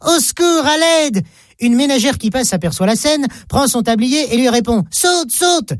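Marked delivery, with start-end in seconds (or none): 0.1-1.2 high-pitched call without real volume; mock cry for help. Child at a burning tenth-floor window, crying out. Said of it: "et crie". 4.9-5.7 high-pitched, contained call; urging call. Acted. Housewife with apron calling up to child, urging. Woman urges child to jump.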